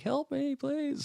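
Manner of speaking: high, soft plea for help; a tiny faint voice pleading from a box; muffled cry for help. Retold or imitated. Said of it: "making noises like".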